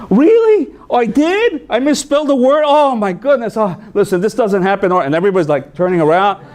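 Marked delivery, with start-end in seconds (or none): 0.1-4.9 high, loud, gushing shock; loud surprise. Retold or imitated. Teacher caught misspelling, in high, loud flustered surprise. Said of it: "she said".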